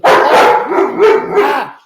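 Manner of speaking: high, loud startled scream; loud high surprised shriek. Real, not acted. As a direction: shriek as the dog jumps. Dog jumps suddenly.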